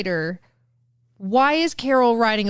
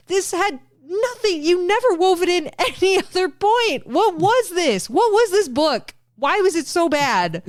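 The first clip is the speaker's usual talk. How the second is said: high-pitched, incredulous outburst at a bad book; exasperated rant; 8 semitones higher than usual. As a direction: wail in comic outrage, drawing words out.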